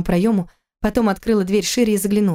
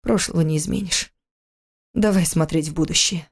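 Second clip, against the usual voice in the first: calming. Acted. Husband calming her.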